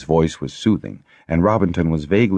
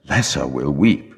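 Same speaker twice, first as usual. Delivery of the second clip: ordinary delivery, nothing marked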